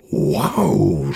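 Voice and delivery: low, drawn-out wow of wonder; amazed. A narrator's reading. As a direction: marvel at it. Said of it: "staunt Wimmelbiene".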